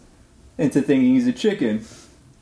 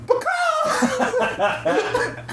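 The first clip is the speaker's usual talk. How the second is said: high, cartoonish chicken squawk blurted at the counter. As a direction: squawk like a hypnotized chicken ordering food. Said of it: "and just goes".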